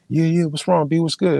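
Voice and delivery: startled brother asking what happened; worried alarm. Retold or imitated. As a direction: ask fast what's wrong, alarmed. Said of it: "He's like".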